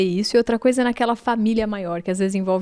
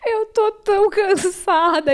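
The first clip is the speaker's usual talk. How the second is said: high, drawn-out tired wail; retold weepy complaint, pitched way up; mock tearful; 10 semitones higher, slower than usual. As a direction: imitate crying from exhaustion, slowly. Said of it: "chorando à noite".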